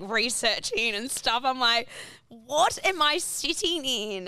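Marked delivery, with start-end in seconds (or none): 2.3-4.3 bewildered. Retold or imitated. Bewildered at odd seatmates, retold.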